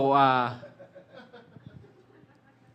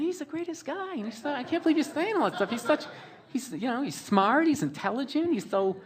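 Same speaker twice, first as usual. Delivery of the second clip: put-on much higher, fast gushing girlfriend voice; 13 semitones higher, 7 dB louder than usual. Girlfriend taking him aside to praise his dad, high gushing voice. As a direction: rave about the dad like the starry-eyed girlfriend.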